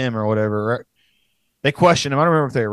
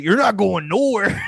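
slow, higher gravel-free wrestler growl imitation; Macho Man Savage impression; 7 semitones higher than usual. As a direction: ham up a wrestler's promo line. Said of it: "Randy Macho Man Savage".

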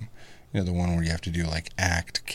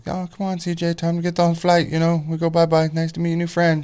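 put-on high, sing-song baby talk to the kid; mock cheery dad; 10 semitones higher, 6 dB louder than usual. Dad retelling hurrying his son away, in high baby talk. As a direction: coax the toddler along sweetly. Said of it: "And I was like".

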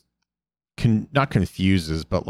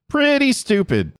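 smirking retort. Retold or imitated. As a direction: toss it out high with a cheeky smirk. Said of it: "Sokka says with a smirk".

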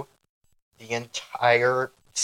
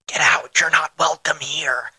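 whispered hiss; 3 semitones higher, 5 dB louder than usual. Acted. Theatrical banishing of listeners.

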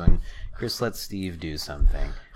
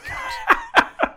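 laughing squeal; 4 dB louder than usual. Real, not acted. Shock at already placed piece.

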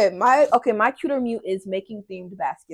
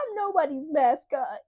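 higher put-on dog voice; voicing the dog; 6 semitones higher than usual. Dog objecting to hosts, higher mock protest. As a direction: speak up for the dog, pitched high. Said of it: "She said".

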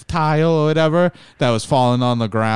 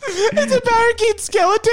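laughing high-pitched squeal; 3 dB louder than usual. Real, not acted.

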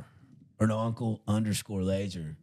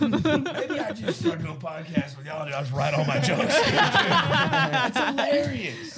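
laughing; 11 semitones higher, 5 dB louder, slower than usual. Real, not acted. Laughing hard at a joke.

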